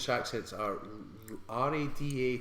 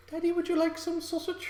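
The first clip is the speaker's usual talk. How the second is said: put-on very high childlike voice; high squeaky kid voice. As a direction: squeak it like a little boy. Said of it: "He's like".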